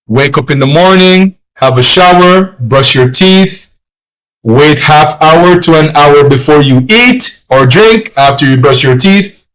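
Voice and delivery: normal talking voice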